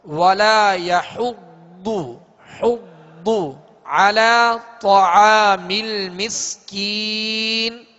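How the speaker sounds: slow, drawn-out chanted Quran verse; slow tajweed recitation. Shown as a demonstration. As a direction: chant each word long and melodic. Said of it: "teacher recites verse".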